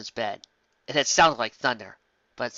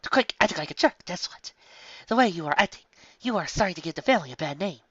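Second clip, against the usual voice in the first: scolding mother; 5 semitones higher than usual. A narrator's reading.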